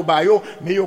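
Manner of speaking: ordinary delivery, nothing marked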